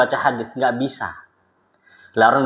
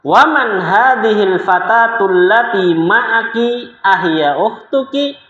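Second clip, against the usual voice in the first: slow, high, careful Arabic dialogue reading; slow lesson reading; 8 semitones higher, 4 dB louder, much slower than usual. Shown as a demonstration. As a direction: read each Arabic phrase slowly for the class.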